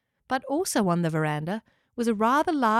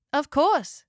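higher, welcoming character voice; friendly talking house; 7 semitones higher, 4 dB louder than usual. A narrator's reading. Locker agrees Spike may come, friendly house voice. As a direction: agree readily and kindly.

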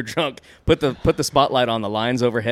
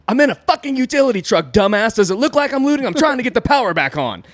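higher, louder, furious telling-off; retold angry yell; 11 semitones higher, 6 dB louder than usual. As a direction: snap back at the cop, raised and hot. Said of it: "I was pissed off".

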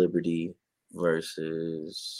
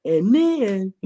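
mock surprise; 13 semitones higher, 6 dB louder than usual. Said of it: "I was like".

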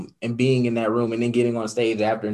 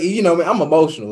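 ordinary delivery

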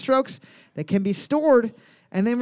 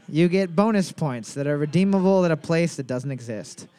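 ordinary delivery

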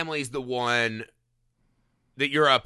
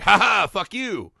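mocking God; 5 semitones higher, 4 dB louder than usual. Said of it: "then it's like".

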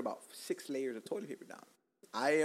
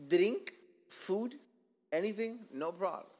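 usual speaking voice

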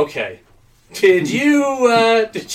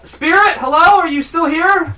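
calling out; 9 semitones higher, 4 dB louder than usual. Acted. Calling into the vent.

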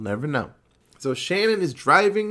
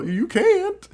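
mock creepy refusal; 4 dB louder than usual. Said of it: "And it's like".

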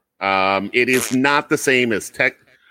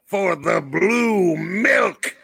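dramatic announcer; 6 semitones higher, slower than usual. Acted.

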